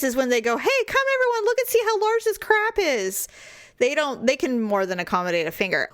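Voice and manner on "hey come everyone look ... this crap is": high-pitched imitated showing off; mock boastful announcement. Mocking guys who summon everyone to look, mock boasting. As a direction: call everyone over in a put-on high voice.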